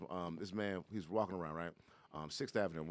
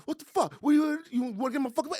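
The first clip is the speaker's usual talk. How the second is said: high-pitched, loud in-your-face outburst; shrieky mock confrontation; retold yelling; 10 dB louder than usual. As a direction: imitate an enraged man yelling. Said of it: "So he's just yelling".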